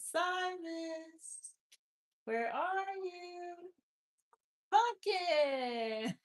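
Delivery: high, drawn-out pet call; calling the cat. Real, not acted. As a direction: sing his name out to find him. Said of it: "I talk like that 'cause he likes when I make the funny sounds".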